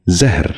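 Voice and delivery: زہر as one syllable, "zehr"; correct زہر. Shown as a demonstration. Teacher modelling for viewers, زہر said as "zehr". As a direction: run h straight into r in زہر. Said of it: "آپ بھی غور سے سنیے".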